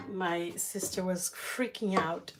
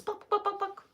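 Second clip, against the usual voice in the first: much higher tapping pok noises; nail tapping sound; 14 semitones higher than usual. Retold or imitated. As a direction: tap out the sound of mom's nail. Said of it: "she goes".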